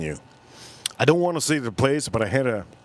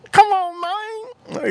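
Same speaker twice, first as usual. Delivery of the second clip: much higher, louder mock Chinese accent; 3 dB louder, much slower than usual. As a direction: squeal it out imitating the restaurant owner. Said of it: "He said like that".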